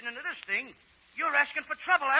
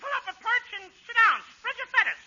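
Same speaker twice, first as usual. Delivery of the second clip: put-on high little-boy voice; 9 semitones higher, 3 dB louder than usual. High kid voice continues.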